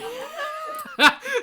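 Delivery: a high, loud burst of laughter. Real, not acted. Streamer cracking up at Smo's squint, loud laugh. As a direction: let out a big real cackle.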